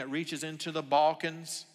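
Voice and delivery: ordinary talk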